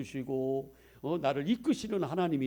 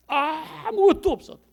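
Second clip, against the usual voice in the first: 아무것도 없어도 cried much higher and louder; 13 semitones higher, 11 dB louder than usual. Shown as a demonstration. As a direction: lift 아무것도 없어도 into a ringing cry. (아무것도 없어도를 높고 크게 외치듯)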